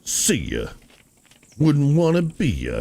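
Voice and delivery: a hint of his sing-song goodbye; imitating him. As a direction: toss off his parting jab in his voice. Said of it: "that's how he just said that".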